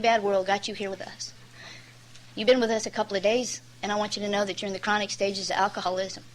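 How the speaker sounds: soft, tender counselor talk; imitating a gentle counselor, hushed and earnest; gentle and quiet. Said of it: "he was really gentle".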